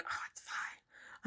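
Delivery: whispered slowly, almost no voice; mock whisper. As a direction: breathe it out like a secret excuse. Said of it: "I used to be one of those people that would be like".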